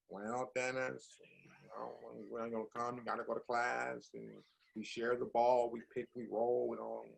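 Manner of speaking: a calm recruiter explaining the rules in a hushed tone; Dean Smith impression. Dean Smith recruiting young Dennis, hushed and fatherly. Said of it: "Dean Smith come in".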